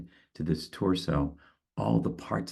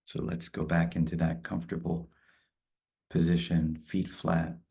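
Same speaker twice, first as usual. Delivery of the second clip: calm, spacious guided meditation. Acted. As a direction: ease them into stillness. Leads a closing meditation.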